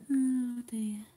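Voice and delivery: ordinary talk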